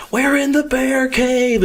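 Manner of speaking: high, drawn-out mock scream; screaming movie quote. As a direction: imitate a panicky buddy screaming a film line. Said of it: "screaming Twister quotes the whole time".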